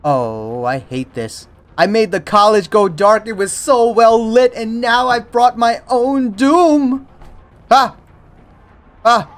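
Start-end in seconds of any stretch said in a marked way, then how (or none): 1.8-9.3 high, loud panic, slowing at the end; panicked. Real, not acted. Gamer to viewers after killing the lights, panicked loud lament. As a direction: wail it, caught in the dark.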